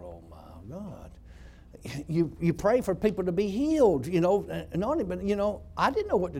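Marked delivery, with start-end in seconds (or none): 0.0-1.1 much softer, lower and slow, awed dismay; hushed dismay. Retold or imitated. Preacher recalling his shock at God's order, a hushed low murmur. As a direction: breathe it out, stunned by the command. Inner reaction to God's instruction.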